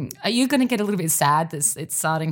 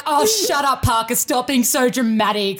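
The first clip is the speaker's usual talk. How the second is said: imitated scoffing, loud and higher at first; dismissive friends; 4 semitones higher, 3 dB louder than usual. Friends brushing off her clot fears, dismissive. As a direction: scoff as friends who don't believe it. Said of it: "And they're like".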